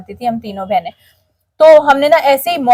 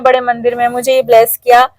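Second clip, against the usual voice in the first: ordinary talk, nothing marked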